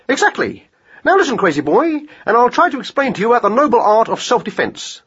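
high-pitched, keen hare voice; instructing hare. Acted. Hare begins lesson on self-defense, high and keen. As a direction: explain earnestly, pitched high.

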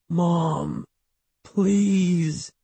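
put-on high, drawn-out child's appeal; pleading. A narrator's reading. Boy begging his mother as the aunt leaves, pleading. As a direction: beg plaintively. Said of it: "he pleaded".

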